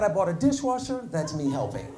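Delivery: quiet self-satisfied reply; smug low deadpan. Retold or imitated. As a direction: say it like it's obvious. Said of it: "I said".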